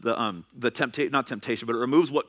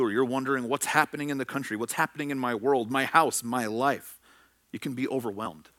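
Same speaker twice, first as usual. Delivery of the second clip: normal talking voice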